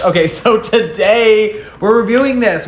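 perky reviewer intro; chirpy host voice. Acted. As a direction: bounce into the review. Reviewer opening, higher voice.

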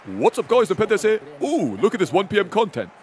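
chirpy intro; Nep impression. Streamer does a friend's chirpy greeting. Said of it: "with Nep, I can only say four words".

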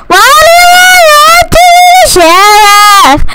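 high, drawn-out exasperated wail; exasperated whine. Real, not acted. Upset at being sheriff.

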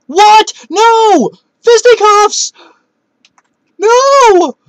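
real high, loud shrieks of disbelief; a gamer shrieking at a lost matchup; outraged screaming. Real, not acted. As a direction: scream at the screen. Opponent lands a finishing move.

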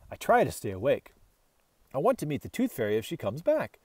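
plain reading voice

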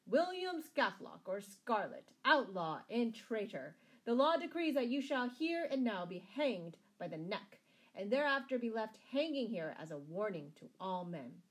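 loud, formal public pronouncement; proclaiming a sentence. A narrator's reading. Sheriff condemning Scarlet before the crowd, proclaiming. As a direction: ring out each charge with authority.

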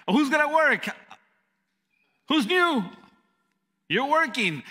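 tossing out holiday shifts like a boss; mock boss. Boss assigning newbies holiday shifts.

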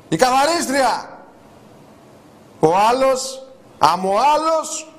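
high, slow, stretched call; jeering proclamation. Acted. Host presenting people in a photo, jeering. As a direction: hold each title out, swooping upward.

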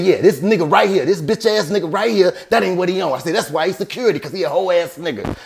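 high, heated retold insults; contemptuous taunting. Insulting the security guard in front of James, contemptuous and heated.

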